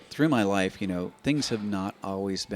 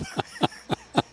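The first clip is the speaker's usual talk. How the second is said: high laughing burst mid-impression; 4 dB louder than usual. Real, not acted. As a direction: laugh through the wife bit.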